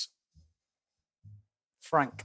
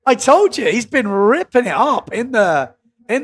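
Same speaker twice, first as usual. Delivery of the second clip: excited; 5 semitones higher, 5 dB louder than usual. Real, not acted. His tipped rider lands a big move.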